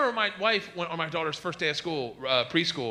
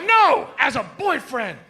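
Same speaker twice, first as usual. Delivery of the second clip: louder, higher, slow exasperated yell; exasperated shout; 8 semitones higher, 7 dB louder, slower than usual. Retold or imitated. Friend yelling the answer at the comic, exasperated. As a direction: shout the correction at him.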